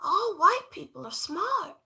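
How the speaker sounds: mock spoiled girl. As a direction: say it like a sweet little girl, high. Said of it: "She said".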